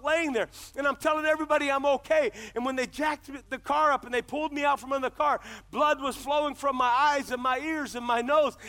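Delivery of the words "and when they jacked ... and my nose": normal talking voice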